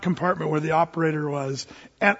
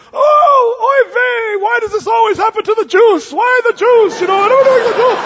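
much higher, louder wailing shout; panicked old lady; 10 dB louder than usual. Retold or imitated. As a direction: cry out in frantic despair.

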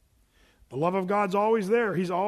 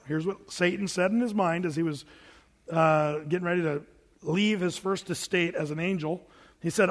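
ordinary delivery

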